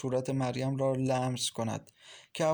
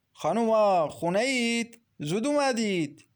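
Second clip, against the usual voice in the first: man calling out, louder and pitched up; thick-voiced man; 8 semitones higher, 4 dB louder than usual. A narrator's reading. Man at the door calls to the women, thick-voiced. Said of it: "مردی با صدای کلوف گفت".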